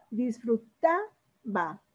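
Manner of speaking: Disfrutaba slowly split, TA lifted, ba dropped; teacher sounding out dis-fru-TA... ba in Disfrutaba; stress on TA in Disfrutaba. Shown as a demonstration. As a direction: stretch Disfrutaba up to TA, pause, then ba. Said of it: "marco todo de a partir de ahí".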